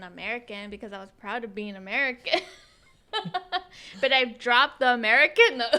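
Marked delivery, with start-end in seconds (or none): 4.0-5.8 loud, laughing admission. Real, not acted. Host confesses her changed label to co-host, laughing. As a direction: say it through bursts of laughter.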